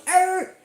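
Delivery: high-pitched mock dog cry; short, high imitation of a hurt dog; imitated dog yelp. Imitates the dog being hit.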